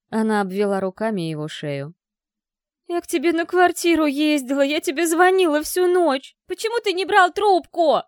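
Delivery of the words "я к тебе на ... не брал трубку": hysterical reproach. Acted. Dasha reproaching Klim.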